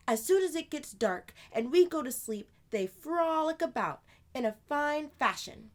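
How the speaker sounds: put-on older sister voice. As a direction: play the lively older sister telling a tale.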